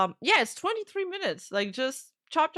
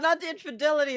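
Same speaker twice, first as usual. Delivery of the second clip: ordinary talk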